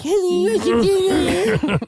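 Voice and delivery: high, slow whiny parroting; mocking whine. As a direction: echo her sweetness back as a nasty sneer. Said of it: "And I'm like".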